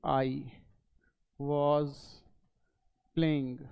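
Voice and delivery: each word spaced out slowly, with long pauses; slow dictation. Shown as a demonstration. Teacher to class, dictating slowly word by word. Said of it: "तो उसका लिखेगा".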